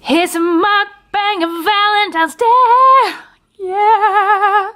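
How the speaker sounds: excited. Real, not acted. Excited announcement to camera.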